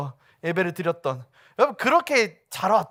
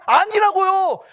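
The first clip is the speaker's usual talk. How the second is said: loud, higher "아니라고요" hammered down with a falling end; emphatic no; 13 semitones higher, 9 dB louder, slower than usual. Acted. Pastor rejecting the congregation's excuse, loud and higher. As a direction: slam the denial. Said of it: "의인이에요?".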